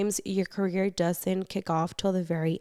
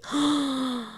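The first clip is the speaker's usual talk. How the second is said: shocked gasp; 5 semitones higher than usual. Real, not acted. Shocked by the confession.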